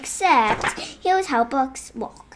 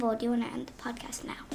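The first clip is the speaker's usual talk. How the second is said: whisper; 2 semitones lower, 12 dB quieter, faster than usual. Real, not acted. Whispered aside to dad.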